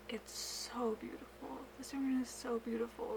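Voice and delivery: soft, on the edge of tears; hushed, genuinely moved; tearing up. Real, not acted. Vlogger admires handspun yarn to viewers, softly tearful. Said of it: "I'm, like, tearing up".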